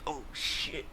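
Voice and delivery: hushed, voiceless imitation of shock; stunned whisper. Short kid just punched in the mouth, stunned. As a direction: let it out as a pained whisper.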